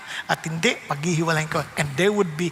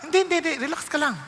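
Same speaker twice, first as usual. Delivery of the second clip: fast reassurance; 6 semitones higher, much faster than usual. Retold or imitated. God voiced calming the worrier.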